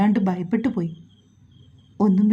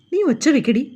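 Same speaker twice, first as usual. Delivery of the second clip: husband taunts his wife, a little higher on നീ; taunting dare; 5 semitones higher than usual. A narrator's reading. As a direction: dare her to scream, sneering at നീ. Husband dares her.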